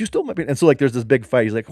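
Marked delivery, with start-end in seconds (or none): 0.0-0.5 high, freaked-out accusation; a mock freaked-out accuser; panicked man. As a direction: squeal it like he just lost something. Voiced panicked victim on bus.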